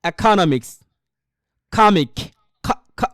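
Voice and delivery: ইকোনমিক্স and খামিক with a rough, throaty খ; Arabic-style kh. Shown as a demonstration. Teacher modelling Arabic kh for English words. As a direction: rasp the খ from the throat. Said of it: "তারা এগুলো easily pronounce করতে পারবেন".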